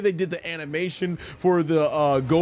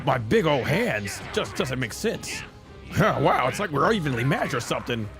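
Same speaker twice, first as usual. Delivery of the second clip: lightly voiced cocky fighter, chuckling between remarks; lightly put-on hero voice; in-character anime hero; 3 dB quieter than usual. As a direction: play the Super Saiyan hero enjoying his own moves. Plays the game speaking as its fighter.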